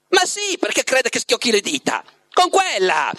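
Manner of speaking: high impatient retort. A narrator's reading. The Master snaps fingers hunting a name, impatient. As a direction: start very high, then drop, irritated at your memory. Guest impatient at failing memory.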